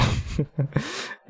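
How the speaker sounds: high breathy giggle; laughing. Real, not acted. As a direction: giggle helplessly at the story. Laughing at emperor dragged story.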